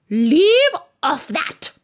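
high, loud, drawn-out command; screamed. A narrator's reading. Queen screams at gardeners to stop bowing. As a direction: scream it slowly as an angry Queen. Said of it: "screamed the Queen".

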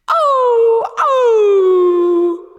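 high, loud dog wail; anguished howl. A narrator's reading. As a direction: wail in misery. Dog wails in the cage.

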